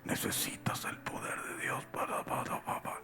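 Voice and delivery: a whisper meant as a shout; Bob Sorge's whispered shout. Retold or imitated. Imitating Bob Sorge preaching at full effort in a whisper. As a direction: shout with no voice at all. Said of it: "él está gritando así".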